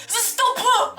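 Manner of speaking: sharp high yelled "Stop!"; imitating a man's sudden high yell; mock yell. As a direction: bark it like the bridge guard. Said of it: "just yells at me".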